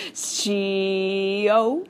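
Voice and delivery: Shio drawn out long and dramatic; dramatic drawn-out Sho. Retold or imitated. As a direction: stretch the name Shio out like a star. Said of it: "drawing it out in a really dramatic way".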